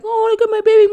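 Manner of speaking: put-on doting parent cooing to a baby; baby talk. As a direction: coo at a baby. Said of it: "when you're kissing a baby".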